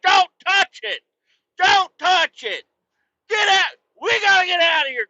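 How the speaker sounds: loud, high urgent yell; frantic loud command; shouting at kids. Retold or imitated. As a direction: bark a warning at the children. Yelling at kids about gum.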